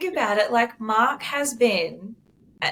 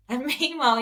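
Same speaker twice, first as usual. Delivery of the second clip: normal talking voice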